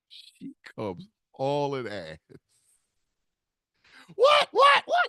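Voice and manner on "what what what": much higher, loud alarmed repeats; mother rushing in, panicked; frantic what. Retold or imitated. Mother answering her son's scream in panic.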